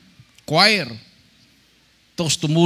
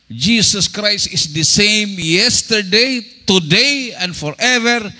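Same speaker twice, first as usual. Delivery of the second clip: slow, loud and higher, proclaiming the verse; preached scripture; 6 semitones higher, 5 dB louder, much slower than usual. Acted. Preacher proclaiming scripture to the congregation, slow and loud. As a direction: ring out each word of the verse like a pulpit declaration.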